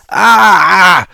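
high loud cry of pain. Acted. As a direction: yelp as you're flung. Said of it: "Alex cried out".

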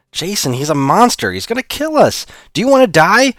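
high, loud panicked pleading. Acted. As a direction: scream sense into him.

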